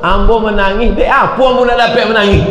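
high, loud mock lament; hammed-up high complaint; acted tearful answer. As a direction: wail it as the crying pilgrim. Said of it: "Apa menangis?".

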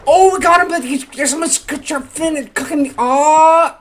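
gasp, then high, loud squealing; ecstatic shrieking. Real, not acted. Player finally catches axolotl, ecstatic shrieking. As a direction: burst into high, loud excited babble.

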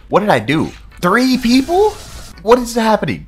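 high, loud what is happening; shocked disbelief. Real, not acted. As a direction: jump up into a startled high yell. More enemies appear.

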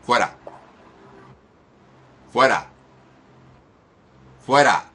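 loud, punched single-word orders; exorcism command. Real, not acted. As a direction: fire off each command with force, pausing between. Commanding demon out.

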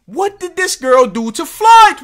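loud, high-pitched demand; mock outrage. Reactor demanding answers on the girl's offence, loud mock outrage. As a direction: burst out as if scandalised.

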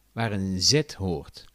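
ordinary talk

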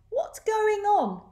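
high, slow storybook animal cry; puzzled animals. A narrator's reading. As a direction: ask in a high, drawn-out voice.

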